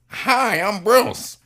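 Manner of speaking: high mock impression of a famous actor saying hello; Bruce Willis voice. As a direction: play a star greeting a kid in a surprisingly high voice. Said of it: "in this voice".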